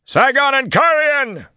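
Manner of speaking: loud, high, drawn-out war cry; shouted. A narrator's reading. Bertholm charging at Terrabonners under lightning, shouting. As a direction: shout it long and high into battle. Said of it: "he shouted".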